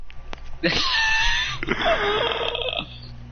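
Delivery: high-pitched helpless shriek of laughter. Real, not acted. Laughing hard at Shots fleeing, high squealing laugh.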